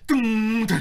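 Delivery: loud, high ringing boom noise; imitated bass drop. Imitating the bass drop that rattled brains, loud and ringing. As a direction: hit it loud and let it ring. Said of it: "and then it would just go".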